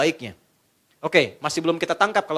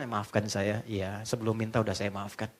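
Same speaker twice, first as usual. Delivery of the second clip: soft, much lower and quieter exchange; gentle apology; 11 semitones lower, 10 dB quieter than usual. Acted. Husband apologises and wife forgives him softly. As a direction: make it tender and hushed.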